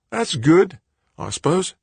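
put-on high, light young man's voice; young Australian man. A narrator's reading. Director's voice, higher.

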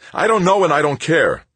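high, loud snapped reply; angry shout. Acted. Tavalera shouting at Stoner he doesn't care. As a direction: throw it back at him.

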